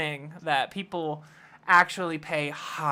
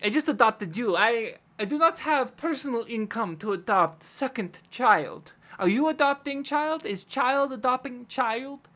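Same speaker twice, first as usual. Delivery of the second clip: put-on higher-pitched, indignant Russian-accented questioning; 6 semitones higher than usual. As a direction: grill them in a heavy Russian accent. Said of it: "I gotta switch to Russian".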